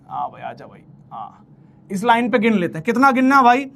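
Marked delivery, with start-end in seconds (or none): 0.1-1.5 coaxing mutter. Real, not acted. Coaxing the line into place.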